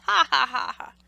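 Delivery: loud jeering ha ha ha; a rabbit's taunting laugh; mocking laugh. A narrator's reading. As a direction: laugh right at him. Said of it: "he began to laugh".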